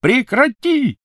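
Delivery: very high, loud shout; shouted command. Acted. Malfoy screams at Crabbe to stop, very high and loud. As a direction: yell to stop him. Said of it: "закричал Малфой".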